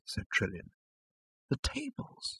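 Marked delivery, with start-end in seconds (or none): 1.5-2.4 hushed. A narrator's reading. Awed listing, hushed.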